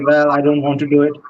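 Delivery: normal talking voice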